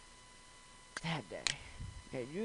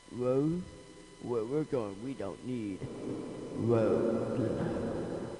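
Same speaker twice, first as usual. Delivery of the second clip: movie quote; 5 semitones lower, 5 dB louder, much slower than usual. Retold or imitated. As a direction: lower dramatic movie line. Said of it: "as I say in the science fiction business".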